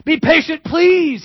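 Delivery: high, loud desperate pleading; begging servant. Acted. Servant begging the king for patience, high pleading. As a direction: beg the king for mercy on your knees. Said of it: "he says".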